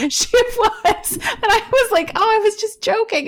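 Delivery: high-pitched, laughing through the words. Real, not acted. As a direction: retell it while giggling, pitched high. Laughing as she retells her joke.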